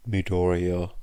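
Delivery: usual speaking voice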